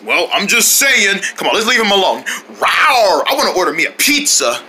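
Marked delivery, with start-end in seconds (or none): 2.6-3.2 playful single rawr; pretend tiger roar.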